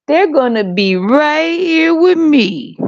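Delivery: high, slow mock-possessive whine; hammed-up slow clingy parent.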